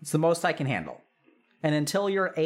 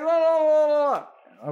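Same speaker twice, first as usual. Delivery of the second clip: high, louder dismissive babble; mocking blah blah; 10 semitones higher, 6 dB louder than usual. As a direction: parent brushing off advice. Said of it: "They're like".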